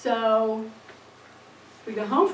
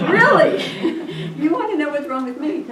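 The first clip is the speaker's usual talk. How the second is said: higher and much louder, laughing in disbelief; half-laughing, mock-indignant reply to her daughter; incredulous laugh; 4 semitones higher, 8 dB louder than usual. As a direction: laugh out the retort in exasperated disbelief. Mother retorts to daughter, laughing.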